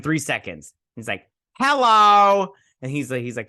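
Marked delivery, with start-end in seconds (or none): 1.6-2.5 high, very loud drawn-out hello; loud arrival greeting. Retold or imitated. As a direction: belt out a hammed-up greeting. Acts hero's arrival.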